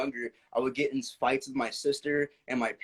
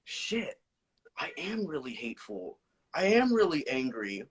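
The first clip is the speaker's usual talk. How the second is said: self-realization; 6 semitones higher, slower than usual. Retold or imitated. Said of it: "I sat down and I was like".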